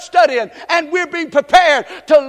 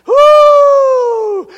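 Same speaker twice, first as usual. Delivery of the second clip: high, loud cry of praise; joyful whoop; 13 semitones higher, 10 dB louder than usual. Real, not acted. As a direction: let out a shouted woo.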